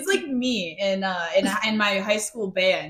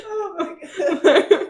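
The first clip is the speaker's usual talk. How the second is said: laughing; 11 semitones higher, 4 dB louder, much slower than usual. Real, not acted. Laughing fit at the line.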